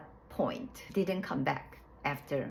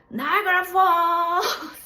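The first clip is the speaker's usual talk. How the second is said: stretched-out, much higher, much louder cry; excited exclamation; 14 semitones higher, 12 dB louder, much slower than usual. Retold or imitated. Nurse calling out as the water breaks, drawn-out excited shout. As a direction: belt it out like a delighted nurse. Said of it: "she said".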